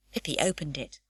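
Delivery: ordinary delivery, nothing marked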